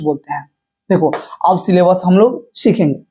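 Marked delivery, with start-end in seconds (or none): none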